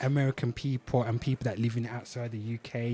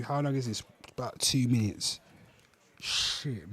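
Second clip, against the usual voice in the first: normal talking voice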